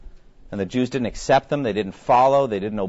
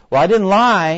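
higher, louder protesting excuse; mock defensive sinner; 6 semitones higher, 8 dB louder than usual. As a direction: play the self-justifying liar.

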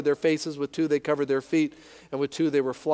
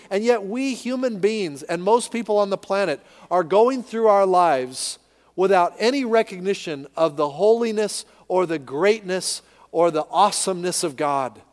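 usual speaking voice

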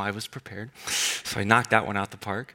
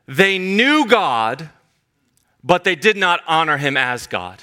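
very slow, higher, weighty; scripture quote; 6 semitones higher, 3 dB louder than usual. Acted. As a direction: read Paul's words slowly and deliberately. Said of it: "he says".

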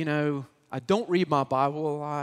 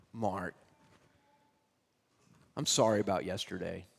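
lower, softer, subdued sorry; soft apology; 9 semitones lower, 10 dB quieter than usual. Retold or imitated. Former bully apologizing to Mark in bathroom: quiet sheepish apology. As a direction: say it low and sheepish.

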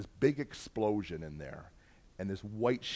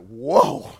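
sudden much louder startled shout; startled whoa; 14 dB louder than usual. Retold or imitated. A boy yelling as the gasoline explodes, loud and startled. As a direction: jump back from the blast.

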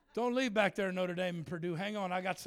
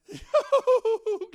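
high, loud whooping laugh; loud burst of laughter; 15 dB louder than usual. Real, not acted. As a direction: let a big joyful laugh burst out.

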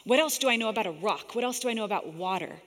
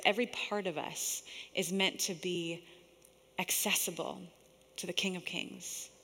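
soft, hushed prayerful guiding; guided meditation; 6 dB quieter than usual. Real, not acted. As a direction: lead them softly into stillness, pausing between phrases. Leads eyes-closed imagining of Jesus.

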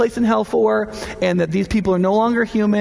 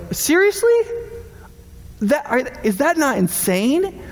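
high rising disbelief; incredulous; 7 semitones higher, slower than usual. Real, not acted. Pastor mocking a letter's claim, high incredulous rise. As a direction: go way up on seriously. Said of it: "incredulous outburst".

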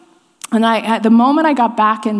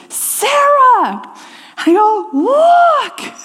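excited calling out; 14 semitones higher, much slower than usual. Retold or imitated. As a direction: shout to her from the door, thrilled. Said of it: "and I go".

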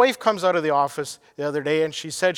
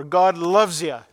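slow, punchy crusade preacher's declaration; emphatic Billy Graham; 4 dB louder, slower than usual. Retold or imitated. Preacher recalls a crusade evangelist he grew up hearing, emphatic. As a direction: lean hard on each word like an evangelist. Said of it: "he would always say so emphatically".

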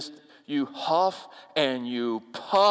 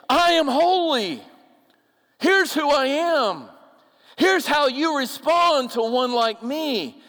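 God proclaiming; 9 semitones higher, 4 dB louder, slower than usual. Acted. Preacher voicing God's proclamation.

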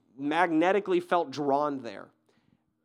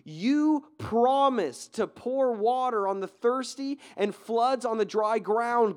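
pleading cry, high and slow; crying out to God; 6 semitones higher, much slower than usual. Retold or imitated. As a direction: beg God with rising fervor, drawn out. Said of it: "he cries out to God".

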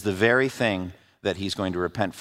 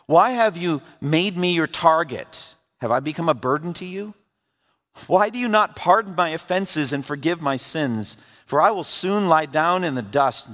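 scripture reading; 6 semitones higher than usual. Acted. Pastor reading Job's complaint to the congregation, earnest scripture reading. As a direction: read Job's lament with weight.

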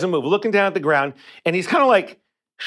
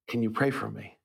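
much softer, almost whispered plea; barely whispering; 6 semitones lower, 9 dB quieter than usual. Retold or imitated. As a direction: lightly imitate a near-whisper, asking for prayer. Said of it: "before barely whispering".

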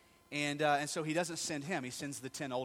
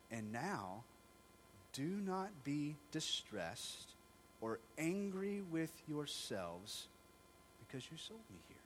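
soft, very slow reassurance; slow soft comforting words; 5 dB quieter, slower than usual. Retold or imitated. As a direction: gently ease their guilt. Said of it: "He's comforting them now".

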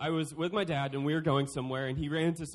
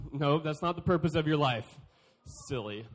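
ordinary talk, nothing marked

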